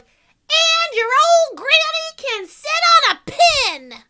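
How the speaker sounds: loud, high, slow taunting yell; pig's yell. Acted. Pig yelling an insult about the wolf's granny. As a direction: hurl the insult at the wolf. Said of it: "the third little pig yelled".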